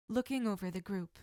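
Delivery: ordinary delivery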